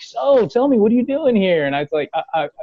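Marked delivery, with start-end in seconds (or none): 0.0-1.6 lightly acted eager question, pitched up; higher, chummy retold voice; friendly host imitation. Voicing the host's friendly question. 2.1-2.5 acted-out starstruck stammer; mock flustered stuttering; tongue-tied. Acting out his tongue-tied answer.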